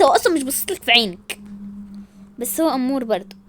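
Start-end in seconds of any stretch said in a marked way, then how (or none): none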